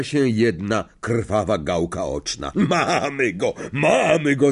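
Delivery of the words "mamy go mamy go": teacher calling out, raised pitch on the repeat; lively, slightly raised cry; excited call. A narrator's reading. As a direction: cheer the class on. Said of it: "zawołał profesor Lapin".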